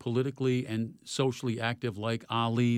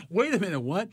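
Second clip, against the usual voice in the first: high-pitched startled objection; mock disbelief; imitated surprise; 7 semitones higher than usual. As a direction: act out someone caught off guard, pitched up. Retold startled reply to refusal.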